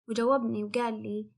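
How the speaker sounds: usual speaking voice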